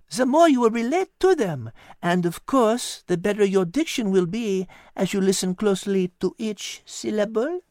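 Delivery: put-on high, lilting French-accented cat voice; a wise cat coaxing gently; cat Liz. Cat Liz coaxing Patrick in a high French-tinged voice.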